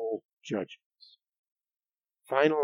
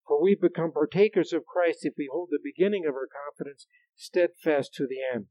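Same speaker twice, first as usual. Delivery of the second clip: raised, emphatic preacher's reading; scripture reading; 4 semitones higher, 4 dB louder than usual. Acted. Preacher reading a warning from Hebrews to the congregation, emphatic scripture reading. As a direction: read the verse out with urgent emphasis.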